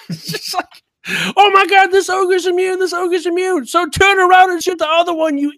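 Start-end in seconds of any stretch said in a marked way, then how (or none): 1.4-5.5 high, loud, exasperated outburst; exasperated yelling. Acted. Gamer to his raid team over the wrong target, exasperated yelling. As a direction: yell it at a clueless teammate, laughter creeping in at the end.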